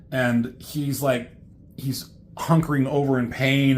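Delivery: usual speaking voice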